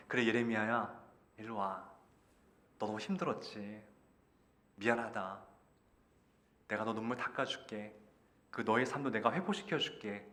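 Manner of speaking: soft, low consoling voice; tender comfort. Pretend. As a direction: gently soothe a weary child, pausing between phrases. Imagines God comforting weary Jeremiah. (낮고 부드럽게 다독이는 목소리)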